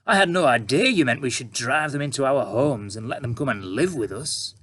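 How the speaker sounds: oozing fake praise; sarcastic reporter. A narrator's reading.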